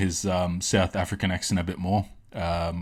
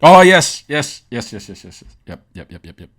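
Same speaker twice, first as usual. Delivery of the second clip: excited, fading; 3 semitones higher, 11 dB louder than usual. Real, not acted. Delighted at finding what he wanted.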